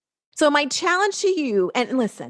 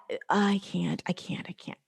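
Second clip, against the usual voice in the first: whispered, much softer scared "I can't"; mock scared whine; 5 semitones lower, 7 dB quieter than usual. Coach imitating a scared marketer, whispered mock fear. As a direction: shrink away like a frightened client. Mocking a fearful viewer.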